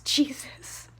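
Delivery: usual speaking voice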